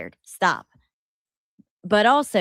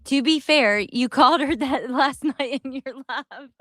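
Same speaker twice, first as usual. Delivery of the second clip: higher, teasing words breaking into laughter; laughing; 6 semitones higher, 3 dB louder than usual. Real, not acted. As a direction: tease while trying not to crack up. Teasing the clip's speaker while laughing.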